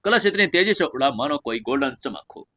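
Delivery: plain reading voice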